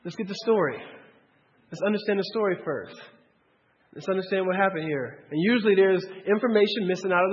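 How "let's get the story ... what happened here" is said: much softer, calming voice; wife calmly slowing things down, softer. Retold or imitated. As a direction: settle everyone down gently. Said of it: "And Sarah's like".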